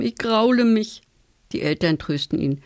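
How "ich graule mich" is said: a touch of sob, higher, understated; sobbing. A narrator's reading. Blind Tim to his parents, understated sobbing. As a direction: read it as a child near tears, lightly. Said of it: "schluchzt Tim".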